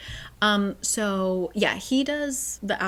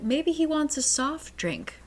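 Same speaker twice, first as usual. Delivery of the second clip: higher-pitched, naive musing; clueless maid; 6 semitones higher than usual. Acted. Maid misreading the Italian warning, in a high, clueless voice. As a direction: guess brightly and obliviously, pitched up. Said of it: "But Millie is like".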